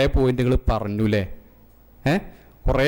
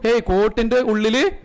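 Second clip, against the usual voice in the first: punchline; 7 semitones higher than usual. Retold or imitated. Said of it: "Nasaruddin".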